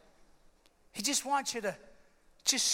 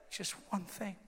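very soft, lower, weak voice; barely voiced frail plea; hushed as if just waking; [name]; 4 semitones lower, 10 dB quieter than usual. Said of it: "I can't talk very loud".

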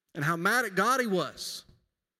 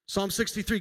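ordinary talk, nothing marked

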